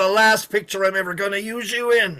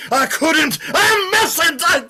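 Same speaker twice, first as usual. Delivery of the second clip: building to a high, slow shouted curse; mock anguished outburst; 12 semitones higher, 6 dB louder, slower than usual. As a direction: ham up the hero breaking down. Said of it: "You say".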